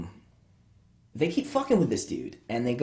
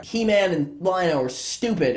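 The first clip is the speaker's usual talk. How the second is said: ordinary delivery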